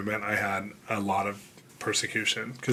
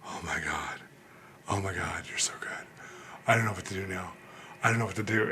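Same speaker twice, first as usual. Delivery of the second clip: whispered, overwhelmed awe; soft whispered wonder; awed whisper; 3 semitones lower, 8 dB quieter than usual. Retold or imitated. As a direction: breathe it out, stunned. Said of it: "I was like".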